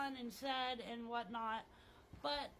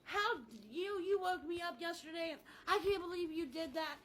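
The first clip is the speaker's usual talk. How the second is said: higher-pitched mock tantrum at a friend; angry outburst; 6 semitones higher than usual. Overreacting to a best friend over a stubbed toe, mock angry. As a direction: lash out in hammed-up outrage. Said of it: "I'm like".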